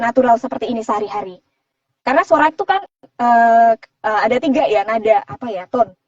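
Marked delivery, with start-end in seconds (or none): none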